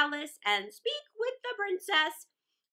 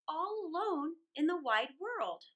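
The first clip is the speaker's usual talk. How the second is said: usual speaking voice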